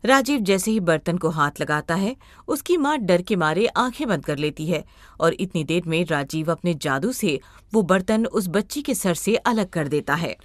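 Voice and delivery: ordinary delivery, nothing marked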